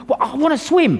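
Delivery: high-pitched mock huffy protest; huffy retort. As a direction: protest like a thwarted, huffy swimmer. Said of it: "he was really huffy about that".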